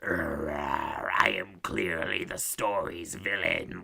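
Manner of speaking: very low, slow, growling villain voice; gruff villain. Acted. Mocking the film's Hans Gruber analog, gruff villain voice. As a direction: drop deep and drag it out like an obvious baddie. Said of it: "his really gruff, like".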